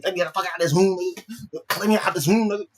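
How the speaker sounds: mock agitated hollering; acted angry demand. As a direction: demand to be let out, worked up. Voicing angry Stack demanding release.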